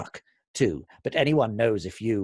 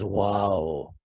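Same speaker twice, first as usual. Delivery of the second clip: sarcastic wow; 3 semitones lower than usual. Retold or imitated. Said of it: "So he sarcastically says".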